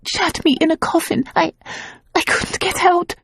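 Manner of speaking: high, halting, frightened; a scared woman telling her lover the nightmare; frightened and stammering. A narrator's reading. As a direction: stumble through it, still scared. Terrified woman recounting her nightmare.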